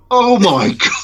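high, loud laughing oh my God; amazed exclamation. Retold or imitated. Fan recalls hearing his idol's voice, high laughing amazement. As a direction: gasp it out in delighted disbelief. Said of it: "And I was like".

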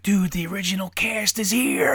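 breathy, whispered-out thrill, building; excited retold exclamation. Dad seeing the original cast return, excited forceful whisper. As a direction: whisper it like you can barely contain it. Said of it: "And I'm all excited".

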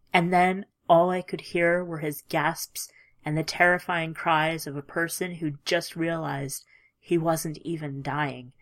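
ordinary delivery

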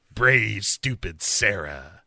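slow, low mock menace; creepy caller voice. Mystery caller taunting Sarah, slow low menace. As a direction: drop low and draw it out tauntingly. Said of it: "the voice says".

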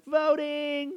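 very high squeal of one word; excited woman. Retold or imitated. Woman cheers back to him, mock high squeal. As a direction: squeal it with delight. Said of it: "And the chick's like".

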